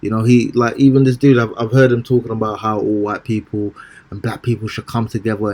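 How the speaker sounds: usual speaking voice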